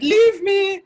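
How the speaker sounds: high, loud dramatic cry of Leave me; emo boy. Acted. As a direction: overwrought brooding hero shout. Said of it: "I wanna be like, emo boy moment".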